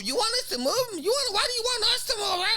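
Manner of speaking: very high squawking caricature; mock outraged Karen. Karen arguing over the warm-up spot, high squawking. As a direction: escalate into a shrieky mock complaint. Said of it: "So she keeps going".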